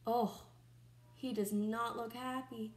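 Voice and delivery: ordinary delivery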